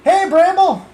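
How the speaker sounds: excited greeting. Real, not acted. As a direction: call out happily to a viewer. Greeting a viewer in chat.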